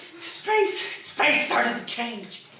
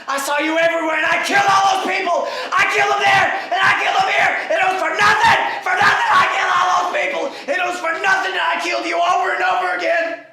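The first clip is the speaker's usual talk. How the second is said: loud, high, raw screaming; anguished shouting; 5 semitones higher, 4 dB louder than usual. Acted. Son screams at his father about the killing, anguished rage. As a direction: hurl the guilt at him in a rage.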